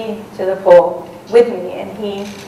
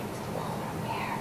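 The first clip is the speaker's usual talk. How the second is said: barely voiced whisper of warning; tense whisper; 18 dB quieter than usual. Telling the guide in the tent about the bear, tense whisper. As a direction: breathe it out so the bear can't hear.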